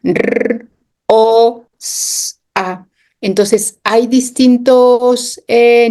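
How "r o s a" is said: "R, O, S, A" each sound of rosa said apart, one by one. Shown as a demonstration. Teacher shows a class the sounds of rosa, sounded out one by one. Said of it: "se oyen en la palabra rosa".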